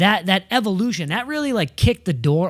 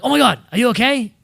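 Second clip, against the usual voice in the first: raised, louder worried check-in; retold alarm; 8 semitones higher, 7 dB louder than usual. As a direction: act out checking on a passenger after a crash. Retold alarm after the rollover.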